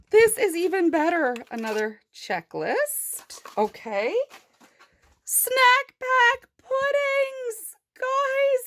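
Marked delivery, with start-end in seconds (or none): 5.2-8.7 high, slow, delighted reveal; squealing over tiny pudding cups; excited squeal. Real, not acted. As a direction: stretch it out in a thrilled squeal. Excited reveal of toy food.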